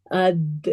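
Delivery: AD with the final D bouncing back; crisp echoing release on the D of اد; qalqala. Shown as a demonstration. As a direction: let the D in اد echo briefly. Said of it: "bounce back ہوتی ہے".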